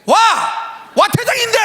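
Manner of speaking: high, loud outraged protest; indignant shouting. Retold or imitated. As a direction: shout back in disbelief at the ejection. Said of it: "제가 막 그랬어요".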